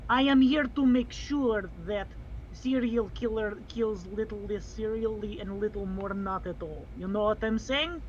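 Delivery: put-on accented protector on official business; earnest protector, explaining. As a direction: explain the mission in the accented character voice. Player plays protector.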